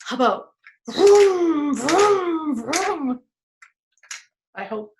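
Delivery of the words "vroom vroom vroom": loud, high revving vrooms, easing down; long swooping engine noises; car engine imitation. Shown as a demonstration. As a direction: rev like a car. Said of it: "What would my car sound like?".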